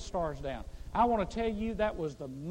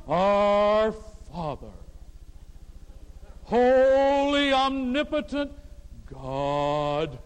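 very slow, loud, higher holy tone; pompous prayer voice; 3 semitones higher, 10 dB louder, much slower than usual. Retold or imitated. Pastor mocks the changed voice people use to pray, slow and grandiose. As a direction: stretch every word into grand piety. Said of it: "Where did they come up with that tone of voice?".

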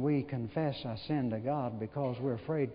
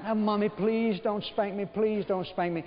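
put-on higher whiny little boy pleading not to be spanked; begging child; 7 semitones higher than usual. Little Johnny begging Mommy not to spank him, higher and whiny. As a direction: beg like a child about to cry. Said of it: "He said".

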